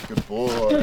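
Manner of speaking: dog praise. Real, not acted. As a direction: sing it up to the dog. Praising the dog after a trick.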